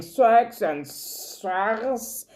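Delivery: "six and six" slow and odd-sounding, a wrong version; mispronounced six. Shown as a demonstration. Teacher imitates learners garbling "six", slowly. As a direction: drag out "six and six" like a learner who gets it wrong. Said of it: "You want to say six, you are saying".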